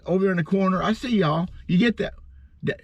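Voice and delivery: ordinary talk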